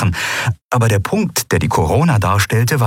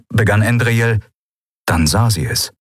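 ordinary delivery, nothing marked

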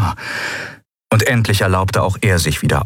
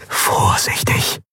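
whispered word of warning; hushed caution; slower than usual. A narrator's reading. Pilot warning himself as the disc might tip, hushed. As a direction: whisper it tensely, drawn out. Tense muttered caution in narration.

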